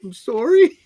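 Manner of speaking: high, loud imitated child apology, rising; cute little girl. Storyteller's punchline about the daughter who kept apologizing, imitated little girl. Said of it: "She just kept saying".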